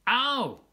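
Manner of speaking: loud, high cry of pain. Acted. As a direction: yelp as if the tusk stings. Cub hurts tusk wrestling.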